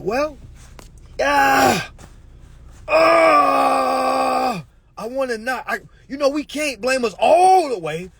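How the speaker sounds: high, loud fake wailing; mock anguished cry. Answering the followers with a hammed-up loud cry. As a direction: wail in hammed-up despair. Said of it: "I'm like".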